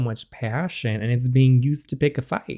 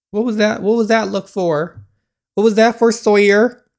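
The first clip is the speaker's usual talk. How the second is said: higher, louder demanding line; reading Sawyer's accusation; 7 semitones higher, 6 dB louder than usual. Acted. Player reading Sawyer's accusing question, indignant.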